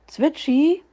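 fox calls the bird's name out loud, voice rising; calling out. A narrator's reading. Fox calls Zwitschi out of the room, loud rising call. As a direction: call out to get the little bird's attention. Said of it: "rief er".

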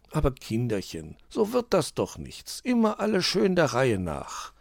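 put-on a patient teacher calming children; gentle admonishing. A narrator's reading. Onkel Uhu gently reminds children to take turns. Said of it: "mahnte der Uhu".

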